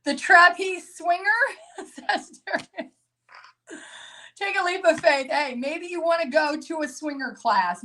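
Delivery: high-pitched, cracking up mid-sentence; helpless laughter breaking into the words; laughing hard. Real, not acted. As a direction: get the words out through real giggles. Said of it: "I'm laughing so hard".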